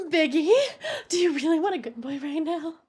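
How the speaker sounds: high, laughing mock-sobbing tease; playful fake crying at Biggie; mock tearful. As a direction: whimper it half-laughing. Teasing mock-hurt voice to Biggie.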